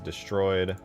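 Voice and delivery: ordinary talk, nothing marked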